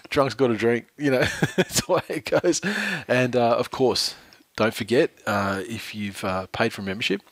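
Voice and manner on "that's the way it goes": laughing. Real, not acted. Laughing at the drunk joke.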